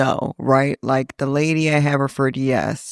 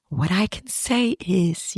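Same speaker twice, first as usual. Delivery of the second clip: slow, softer, primly earnest; slow prim after-school special; 4 semitones higher, 4 dB quieter, slower than usual. Retold or imitated. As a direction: mimic the sister's solemn TV-special tone. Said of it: "after-school special face. and voice".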